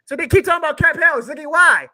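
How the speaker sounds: high, loud exasperated rant; fired up. Real, not acted. Fan ranting to his co-host, fired up and loud. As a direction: vent at the whole league. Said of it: "I like Fired Up [name]".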